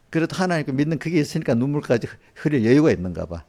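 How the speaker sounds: ordinary talk, nothing marked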